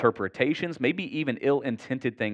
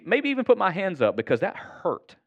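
ordinary talk, nothing marked

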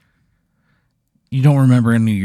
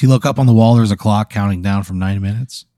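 usual speaking voice

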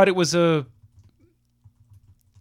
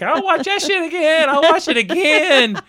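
much higher and louder, laughing; high excited imitation; 12 semitones higher, 6 dB louder than usual. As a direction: squeal it like a hyped fan. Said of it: "Every week you'll be like".

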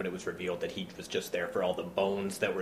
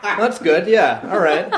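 high, loud, laughing mock-dismissive brush-off; unbothered tourists; 11 semitones higher, 11 dB louder than usual. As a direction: shrug it off through laughter. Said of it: "we just kinda like".

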